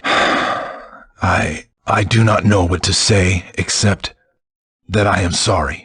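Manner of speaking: hushed, heavy-hearted apology. Acted. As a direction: breathe out the apology in grief. Learns friend's sister is dead.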